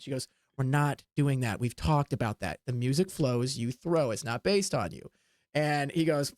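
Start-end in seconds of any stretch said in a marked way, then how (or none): 0.6-5.1 firm refusal. Acted. She firmly refuses, lower voice.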